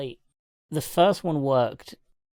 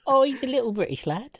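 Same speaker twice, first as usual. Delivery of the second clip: high, laughing mock Cockney lad; cooing over the little British lad, in a higher voice; mock Cockney boy; 11 semitones higher than usual. Teasing the film's British kid, laughing high mock Cockney. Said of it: "Little Cockney charmer".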